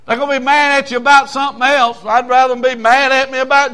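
loud high preaching shout; fiery rebuke. Real, not acted. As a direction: push them hard, full preacher force.

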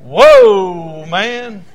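a loud stunned whoa; amazed Adam. Acted. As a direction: gasp it out like a man seeing a wonder. Adam amazed at seeing Eve.

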